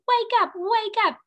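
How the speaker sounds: a teacher playing a waking game; pretend wake-up call. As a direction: call out like a cheerful alarm. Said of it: "when you hear the whistle".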